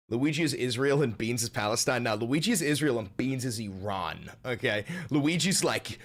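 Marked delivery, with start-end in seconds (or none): none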